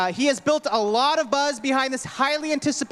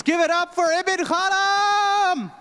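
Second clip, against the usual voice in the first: hype call; 5 semitones higher, slower than usual. Acted. Emcee hypes crowd after poet.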